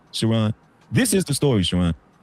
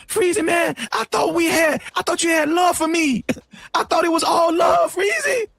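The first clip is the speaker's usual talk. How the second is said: whiny hurt friend; 12 semitones higher than usual. Retold or imitated. Imitating Anton's hurt phone call.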